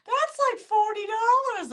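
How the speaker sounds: high mock protest, slowing down; scandalized mother. Mother objecting to daughter's double gin order, high protest. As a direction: imitate a high-pitched objection to the price.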